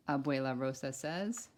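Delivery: loud, bright storybook read-aloud; reading to children. Acted. Teacher reading a picture book to kindergartners, loud and lively. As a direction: read it big for a class of kids.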